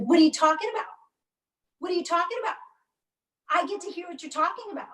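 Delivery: a lightly voiced husband barging in, insisting; demanding husband. Mother voicing husband storming into the room, demanding.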